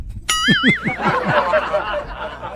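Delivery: long, high wheezing laugh; mocking laugh. Real, not acted. Host laughs at the caller's backfired challenge, mocking. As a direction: laugh helplessly at your own jibe.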